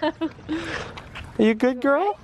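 high-pitched coo to a dog; dog talk. Real, not acted. Owner checking on her dog, high cooing.

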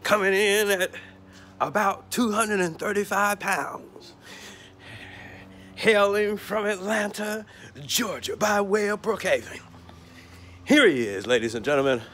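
mock announcer calling the champ into the ring. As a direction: hype the fighter like a ring announcer. Mock wrestling ring announcer intro.

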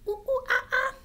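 high-pitched monkey hoots; monkey call. Acted. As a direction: do a playful high monkey chatter. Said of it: "I like acting like a monkey".

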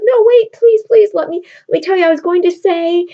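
loud, high, hurried begging; desperate pleading. Retold or imitated. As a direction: beg her not to go. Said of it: "And he says".

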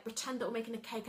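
usual speaking voice

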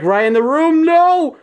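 real fright, pitch shooting up; alarmed. Real, not acted. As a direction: voice climbing to a loud, high no. Scared reaction in horror game.

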